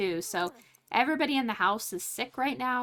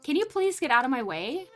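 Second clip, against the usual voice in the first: ordinary delivery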